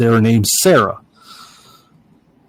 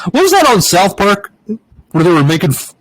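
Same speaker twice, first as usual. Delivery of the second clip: ordinary talk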